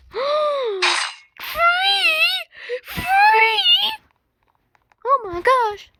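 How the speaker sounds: high, rising disbelieving gasps; astonished. Real, not acted. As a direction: gasp in disbelief. Finding something free.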